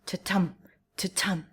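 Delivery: weak then strong beat, ta-TUM; iambic ta-tum. Shown as a demonstration. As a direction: tap out "ta-tum" with the stress on the second beat. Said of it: "produces the rhythm ta-tum".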